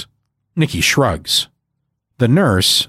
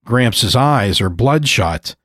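plain reading voice

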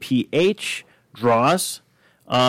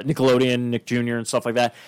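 ordinary talk, nothing marked

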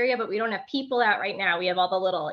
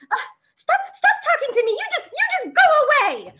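put-on very high, louder bossy little-girl voice; tiny harsh girl voice; 5 dB louder than usual. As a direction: squeak it out like an annoyed toddler. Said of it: "And then she, like".

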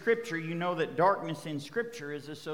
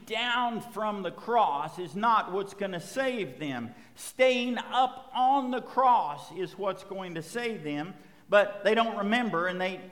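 raised, emphatic pulpit delivery; fervent preaching; 4 semitones higher, 4 dB louder than usual. Acted. Pastor preaching the crucifixion to the congregation, full fervor. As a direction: preach it out, building over the repeats. Passionate opening preaching run.

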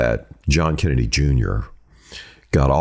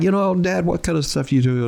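put-on curious teenage son. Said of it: "He'd always been like".

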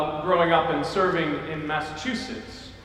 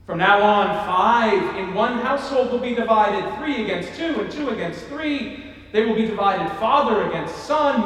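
scripture reading; 3 semitones higher, 3 dB louder than usual. Acted. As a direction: proclaim the gospel words. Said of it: "reading Jesus' words".

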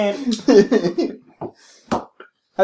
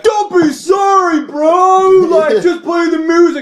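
high, drawn-out heckler yell; slow, high mock shout; exasperated shout; 10 semitones higher, 3 dB louder, much slower than usual. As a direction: imitate someone hollering at the band. Acted heckler shouting at band.

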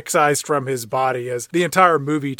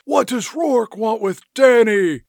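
Nicolas Cage voice; 8 semitones higher, 3 dB louder than usual. Acted. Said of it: "Nicolas Cage says".

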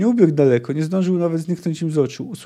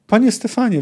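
high, loud pleading; babbled plea; 8 semitones higher, 5 dB louder than usual. A narrator's reading. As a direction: plead desperately for rescue. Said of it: "wybełkotał doktor Silifant".

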